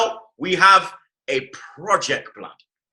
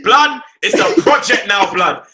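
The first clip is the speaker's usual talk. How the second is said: higher, louder hype shout; excited shout; 6 semitones higher, 8 dB louder than usual. Real, not acted. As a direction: hype the crowd up. Hyped football banter, shouting.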